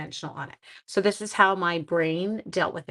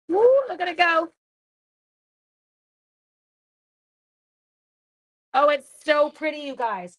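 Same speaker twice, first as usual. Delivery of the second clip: squealing with delight, much higher and louder; excited; 8 semitones higher, 4 dB louder than usual. Real, not acted. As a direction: let the joy burst out. Excited at stamp result.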